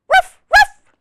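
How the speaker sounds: high, loud double woof; puppy woof. Acted. Biscuit answering the reader, high loud puppy woofs. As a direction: yip like an eager little puppy. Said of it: "And Biscuit says".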